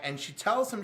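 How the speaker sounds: ordinary talk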